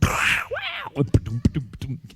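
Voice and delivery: loud crash, then bouncing putt-putt noises; mouth-made clatter of flying stumps; imitated stump smash.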